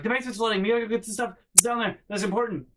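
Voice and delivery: high, fast, frantic mom voice; breathless alarm, mocking mom; panicked mom. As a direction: imitate a mom panicking about the flood. Mom panicking over flooded basement.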